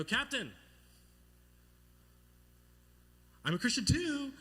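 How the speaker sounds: high, loud, eager shout; eager calling up. Retold or imitated. Swimming boy telling pirate captain his news, eager shout. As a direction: call up proudly to the captain.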